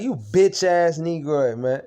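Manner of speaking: yelling the insult at him again; retold angry yell. Venting at his roommate, a loud angry insult. Ranting about roommate's betrayal.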